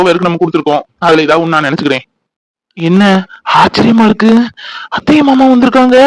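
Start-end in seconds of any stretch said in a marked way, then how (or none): 2.8-6.0 surprised delight. Acted. Daughter surprised relatives came.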